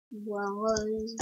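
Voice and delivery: bois rose murmuré, amusé, plus doux; répété tout bas. Real, not acted. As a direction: se répéter bois rose pour soi, en souriant. (bois rose repris doucement pour rire)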